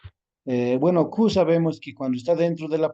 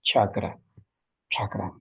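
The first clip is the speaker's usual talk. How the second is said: softly repeated; 5 semitones lower than usual. Shown as a demonstration. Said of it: "así".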